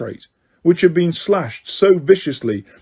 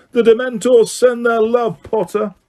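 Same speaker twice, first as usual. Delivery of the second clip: high, jeering call across a crowd; mocking shout; 8 semitones higher than usual. A narrator's reading. Malfoy taunting Harry through the crowd, jeering shout. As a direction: taunt him from across the hall. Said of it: "who shouted through the crowd".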